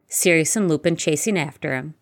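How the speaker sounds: usual speaking voice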